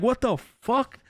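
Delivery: high, bewildered outcry; startled disbelief. Retold or imitated. Whole house waking to banging, startled. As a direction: woken up alarmed, pitch leaping up.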